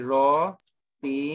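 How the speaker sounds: roti-su slowly split into ro, ti, su; slow teaching take of roti-su; syllable by syllable. Shown as a demonstration. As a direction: sound out roti-su in separate, drawn-out pieces. Teacher sounds out term by syllable.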